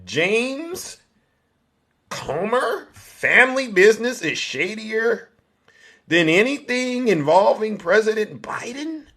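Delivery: high-pitched, put-on astonished questioning; mock incredulity. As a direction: ask it way up high, feigning shock. Mock questioning of a rival's scandal.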